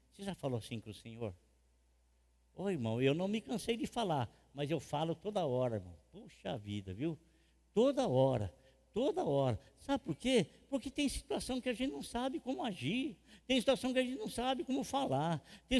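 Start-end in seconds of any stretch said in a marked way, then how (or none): none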